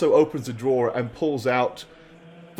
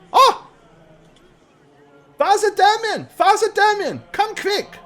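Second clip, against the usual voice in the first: high, loud call down the hall; fawning priest shouting for a colleague; 6 dB louder than usual. Acted.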